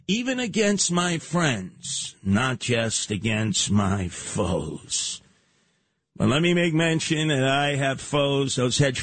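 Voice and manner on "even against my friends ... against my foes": drawn-out words sinking very low; slow, menacing descent into a low register; ominous slow-down. Acted. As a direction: let each word stretch and drop like a mock threat. Ominous close of psycho boast.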